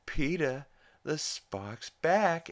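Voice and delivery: imitating Lois. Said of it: "she's like".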